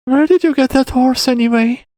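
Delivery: put-on high-pitched child's question; boy asking. A narrator's reading. Boy asks about the horse, high child voice. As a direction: ask in a high little-boy voice. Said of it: "the boy finally asked when he had finished eating".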